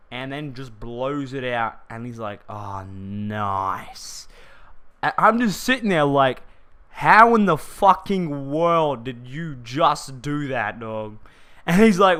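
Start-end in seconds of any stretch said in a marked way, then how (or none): 2.5-4.3 low, laid-back voice of a seasoned smoker; lightly voiced cool mate; a hint of a chilled-out stoner; relaxed impressed mate. 6.9-11.2 louder, slow, stunned disbelief; mock stunned question to his mate; incredulous. Amazed at friend's smoking.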